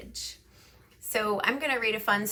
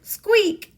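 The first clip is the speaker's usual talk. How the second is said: high, louder startled squeak; a shocked little mouse squeak; alarmed mouse squeak; 13 semitones higher, 8 dB louder than usual. Acted.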